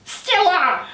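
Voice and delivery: high-pitched imitated shriek; mock early-morning shriek. Waking the whole boat at six, imitated shrieking. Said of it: "woken up at six A M by shrieking".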